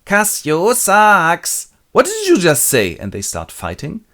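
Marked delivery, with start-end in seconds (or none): 0.1-1.7 high, loud, drawn-out jeer; singsong playground provocation, very slow; provoking taunt. Acted. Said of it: "provokes him". 1.9-3.0 high, loud angry comeback; hammed-up outraged demand; offended challenge. Drunk Cassio takes offence.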